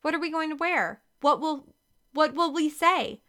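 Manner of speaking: high, urgent string of questions; exclaiming. A narrator's reading. Girl plans the switch with her twin, exclaiming high. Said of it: "Ashley exclaimed".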